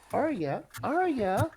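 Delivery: escalating name call to someone not listening. Acted. Calling a distracted companion.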